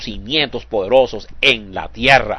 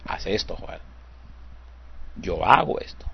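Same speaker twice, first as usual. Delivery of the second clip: soft, close and personal, much quieter; like God speaking inside you; intimate inner voice; 6 semitones lower, 12 dB quieter than usual. Shown as a demonstration. Preacher voicing God's inner voice to the congregation, soft and intimate. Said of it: "No, Dios nos dice".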